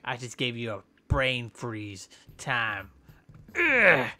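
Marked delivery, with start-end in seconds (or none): 3.5-4.1 loud strained attack grunt; effort grunt. Pretend. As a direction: grunt as you strike.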